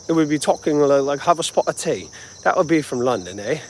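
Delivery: playful put-on London accent. Shown as a demonstration. Vlogger showing a commenter he is no Londoner, mock London accent. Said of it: "more of an accent like this".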